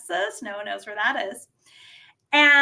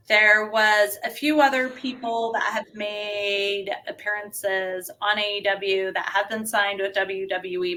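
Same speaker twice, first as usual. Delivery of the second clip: normal talking voice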